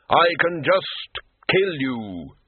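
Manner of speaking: slow, hollow voice of Death; Death, slow and grave. A narrator's reading. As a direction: speak as Death, unhurried and final. Death speaks in held voice.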